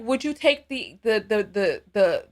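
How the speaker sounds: stuck repeating the over and over; mock stutter. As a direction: stumble on the like a broken record. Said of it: "I'm stuttering".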